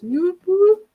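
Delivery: high party whoops; whoop whoop cheer. Retold or imitated. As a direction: hype it up like at a party. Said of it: "just like that".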